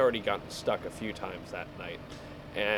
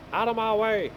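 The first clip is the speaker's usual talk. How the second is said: higher, louder shout to clear the road; yelling out of my way; 10 semitones higher, 4 dB louder than usual. Real, not acted. Driver yelling at traffic blocking the road, mock shout.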